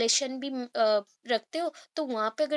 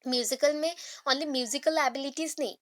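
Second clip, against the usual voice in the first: ordinary talk, nothing marked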